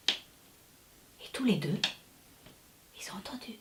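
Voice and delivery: slow hushed whisper. A narrator's reading. Narrator telling how they listened in the dark, whispering. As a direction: whisper it to build suspense.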